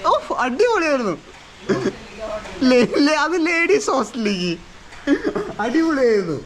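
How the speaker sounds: playful teasing. Real, not acted. Teasing about the ladies hostel.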